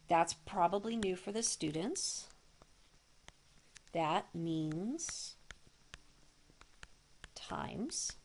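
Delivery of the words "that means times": usual speaking voice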